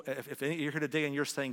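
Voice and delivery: ordinary talk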